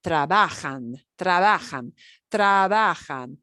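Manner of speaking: tra-BA-jan, second to last syllable stressed. Shown as a demonstration. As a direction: hit the ba in trabajan. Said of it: "the second to last syllable".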